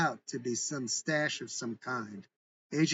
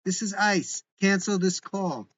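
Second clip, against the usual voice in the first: loud, higher commanding yell; yelling into phone; 6 semitones higher, 6 dB louder than usual. A narrator's reading. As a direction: bark the order down the line. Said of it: "yelling down to the phone".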